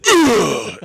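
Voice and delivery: high, loud pained grunt on impact. Retold or imitated. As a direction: grunt as you hit the ground. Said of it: "and I just".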